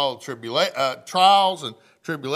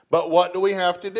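quick, raised challenge to the room; emphatic question; 4 semitones higher, 6 dB louder, faster than usual. Real, not acted. Preacher challenging his congregation, fast raised question. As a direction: push the question out fast and louder.

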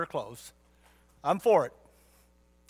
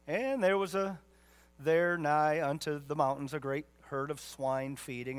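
ordinary talk, nothing marked

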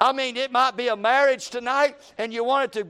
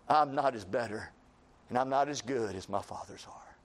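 low, soft, beaten-down mutter; defeated Elijah murmur; 10 semitones lower, 8 dB quieter than usual. Acted. Prophet Elijah, looking back at his fathers, in a low defeated murmur. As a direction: sink into it like a man giving up. Elijah voiced in self-doubt.

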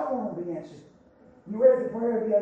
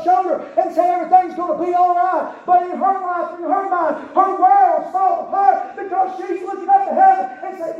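loud preaching with pleading quotes; full-force preaching; 5 semitones higher, 7 dB louder than usual. Acted. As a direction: push it hard like a revival preacher. Preacher rising again.

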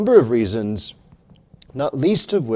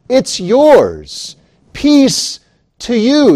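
louder, higher ringing proclamation; proclaiming peace; 9 semitones higher, 9 dB louder, much slower than usual. Acted. Risen Christ to his disciples, ringing joyful proclamation. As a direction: throw the gift out with joy.

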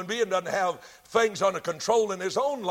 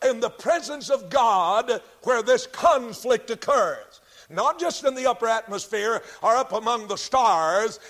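emphatic preaching; 4 semitones higher, 5 dB louder than usual. Real, not acted. Preacher proclaiming war in heaven to his congregation, emphatic preaching.

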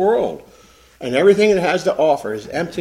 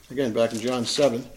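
ordinary delivery

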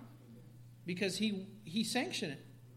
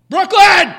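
shouted name; 12 semitones higher, 25 dB louder than usual. Shown as a demonstration. Parent shouting daughter's name to get her attention, loud call. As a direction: yell it loud and high across the room. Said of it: "Second time, I said it a little bit louder".

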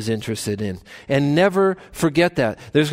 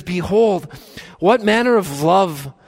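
slow, higher and louder cry of wonder; amazed exclamation; 5 semitones higher, 3 dB louder, slower than usual. Retold or imitated. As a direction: call it out in awe, stretching each word. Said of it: "shouting this out in amazement".

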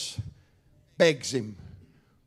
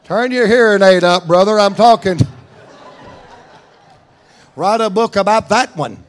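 teasing call-out; 3 semitones higher, 8 dB louder than usual. Real, not acted. Teasing a congregant.

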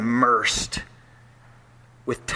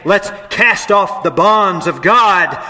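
louder, higher and slow, a taunting rebel; proclaimed like a defiant crowd; mock defiant cry; 6 semitones higher, 7 dB louder, slower than usual. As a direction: belt out the rebels' boast. Quoting the rebellious nations.

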